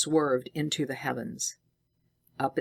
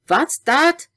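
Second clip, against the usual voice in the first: startled cry, much higher and louder; sudden exclamation; 11 semitones higher, 8 dB louder than usual. A narrator's reading. Scientist hearing a whistle on the ice, startled. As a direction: jump at the sound. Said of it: "exclaimed the scientist suddenly".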